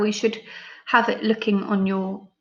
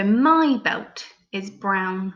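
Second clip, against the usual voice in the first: slow, each word set apart; slow dictation; much slower than usual. Shown as a demonstration. Teacher dictating the sentence slowly. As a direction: say it slowly for pupils to write. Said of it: "sounding out that word belt slowly".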